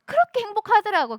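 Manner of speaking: 높고 크게 들뜬 목소리; 벅찬 기쁨을 터뜨리듯; 행복함. Real, not acted. 새벽 수영의 기쁨 회상. (들뜬 행복)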